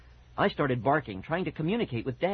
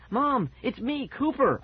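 high, urgent plea; frantic high-pitched call; furious barking; 11 semitones higher, slower than usual. A narrator's reading. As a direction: insist on being recognized, pitched way up. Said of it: "I barked furiously".

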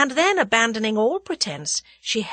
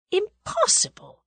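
Impossible, higher and slow, stunned; shocked disbelief; 12 semitones higher than usual. Acted. Tuppence grasping a monstrous idea, stunned Impossible. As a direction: let Impossible rise in horrified disbelief.

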